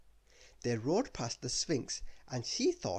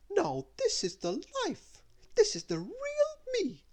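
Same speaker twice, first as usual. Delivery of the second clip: grand announcement; 3 dB louder than usual. Acted. Little Miss Splendid on the Pharaoh's throne, high grand proclamation. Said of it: "she announced".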